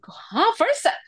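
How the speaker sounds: high-pitched acted double take; surprised. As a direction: replay being caught off guard, voice leaping up. Acting out own surprised reaction. (natingala, taas nga tingog)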